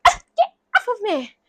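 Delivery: high-pitched retold yelp; startled protest. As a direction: act out wrenching free of a grab. Retold grabbed woman pulls away.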